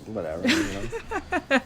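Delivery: high, slow sarcastic shrug; mock indifference. Host mocking nineties indifference, high drawn-out shrug. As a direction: toss it off high and slow like nobody cares.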